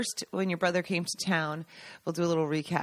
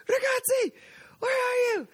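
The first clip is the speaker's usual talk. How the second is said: excited shout. Retold or imitated. Friend calls them up the hill.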